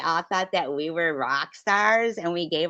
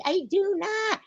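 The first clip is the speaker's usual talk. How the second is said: high squeaky denial; mock indignant Pam; 4 dB louder than usual. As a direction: squeal it, offended. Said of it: "she's like".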